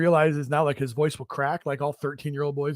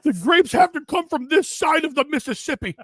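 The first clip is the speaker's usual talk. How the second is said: much higher, lightly hammed-up; silly strict dad voice, pitched much higher; mock dad voice; 12 semitones higher than usual. Continuing the cartoon dad voice.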